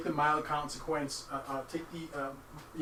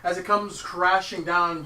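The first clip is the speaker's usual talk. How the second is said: dramatic narration; 4 semitones higher, 6 dB louder, slower than usual. A narrator's reading.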